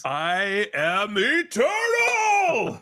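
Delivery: slow booming cosmic shout. Pretend. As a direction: proclaim it like an immortal being. Soundboard clip survived, mock cosmic.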